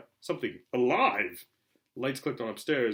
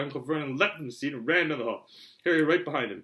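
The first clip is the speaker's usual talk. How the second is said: usual speaking voice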